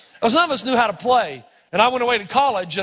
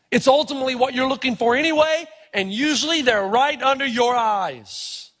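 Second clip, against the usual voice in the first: ordinary delivery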